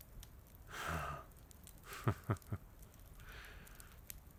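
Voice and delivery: a quiet laugh under the breath; soft breathy laugh. Acted. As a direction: chuckle softly, amused. Villain amused by her answer.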